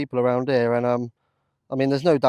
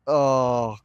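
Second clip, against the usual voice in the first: ordinary talk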